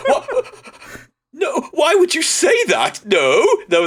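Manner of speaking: high, slow, offended no; shocked denial. Retold or imitated. Man accused by kids, high shocked denial. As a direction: act aghast at the accusation. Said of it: "his reaction is just like".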